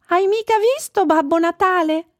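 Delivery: very high, bright call; searching reindeer. A narrator's reading. Reindeer asking a dolphin after Babbo Natale, searching. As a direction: call the question out high as a storybook animal.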